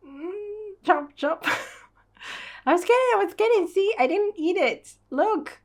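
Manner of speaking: high, loud, fast squeaky pleading; a high, loud squeaky voice protesting I was kidding; squeaky guilty girl. Acted. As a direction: squeal as if caught eating the seed. Voicing Nina caught eating the seed.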